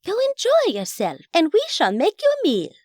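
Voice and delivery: high, hammed-up British accent; bad British accent. A narrator's reading. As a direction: dismiss grandly in badly faked British. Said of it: "in a really bad British accent".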